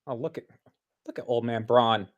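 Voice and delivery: usual speaking voice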